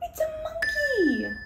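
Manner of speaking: high, loud playful amazement; excited discovery. Pretend. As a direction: reveal it with delighted surprise. Mock discovery for children.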